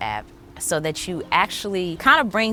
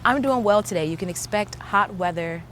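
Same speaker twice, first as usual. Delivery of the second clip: voice assistant voice; 4 dB quieter than usual. Pretend. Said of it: "What would you say?".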